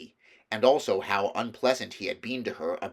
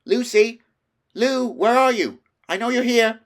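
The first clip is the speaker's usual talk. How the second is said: high, loud calls into the dark; shouting out; 13 semitones higher, 11 dB louder than usual. A narrator's reading. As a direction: call out for Lucy, lost and urgent. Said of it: "He even shouted out".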